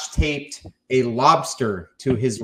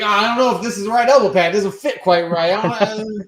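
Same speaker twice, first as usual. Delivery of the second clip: loud, high, rushed mock whining; hammed-up fussing, milk it for the crowd; 6 semitones higher, 6 dB louder than usual. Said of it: "I'm just milking it at this point".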